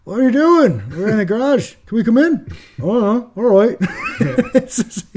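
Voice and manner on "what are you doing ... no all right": high, loud mock-Australian chat; casual Aussie banter in a hammed-up accent; bad Australian accent. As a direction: put on a terrible Australian accent, pitched well up. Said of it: "That's my Australian accent, really bad".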